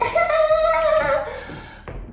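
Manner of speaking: very high put-on girly laugh; mock pick-me giggle. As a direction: imitate a pick-me girl's squealing giggle. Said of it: "Pick me girl" vibes".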